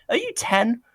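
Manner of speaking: high-pitched, incredulous challenge; incredulous scorn. Real, not acted. Podcaster ridiculing Scots' pride in swearing, incredulous and high. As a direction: pitch leaps up in disbelief.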